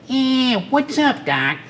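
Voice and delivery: high Brooklyn wise-guy rabbit voice; Bugs Bunny. Acted. Voice actor debuting the character at the studio, Brooklyn rabbit voice. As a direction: slip into a cocky Brooklyn cartoon rabbit. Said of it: "kind of a Brooklyn accent".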